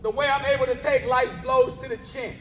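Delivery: rhythmic, punchy spoken word delivery, words hit on the beat; spoken word poem. Acted. Poet performing his piece for a live crowd, rhythmic spoken word. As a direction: perform it like a slam poet on stage.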